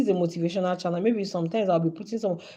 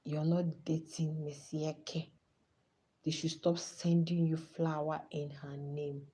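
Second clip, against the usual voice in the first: mocking whisper; 3 semitones lower, 9 dB quieter, slower than usual. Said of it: "to say".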